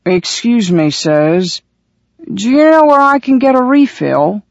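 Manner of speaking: put-on slurred, rising drunk's question; drunken voice. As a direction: play the cheerful drunk. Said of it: "a drunken voice said".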